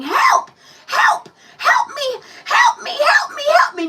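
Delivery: very high, loud mock screams for help; imitating screaming child. Mom imitating daughter screaming help in the pizza place. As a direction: scream help like a kid being carried off. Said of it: "she's literally screaming".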